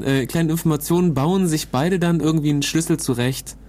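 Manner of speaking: ordinary delivery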